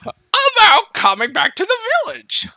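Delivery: very high cartoon old mentor; hammed-up high elder voice; Jiraiya. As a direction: pitch it way up as an old anime sage. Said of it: "you read Jiraiya's lines".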